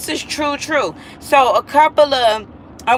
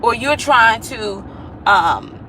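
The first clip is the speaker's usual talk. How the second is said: normal talking voice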